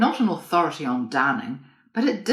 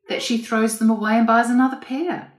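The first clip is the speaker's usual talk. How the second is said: reluctant; 7 semitones higher, 7 dB louder than usual. A narrator's reading. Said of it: "I said reluctantly".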